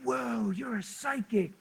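imitating her stunned, quiet wonder; retold fired-up amazement. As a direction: stretch it out in hushed astonishment. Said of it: "she was fired up".

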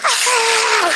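excited shout. Real, not acted. Preacher fired up.

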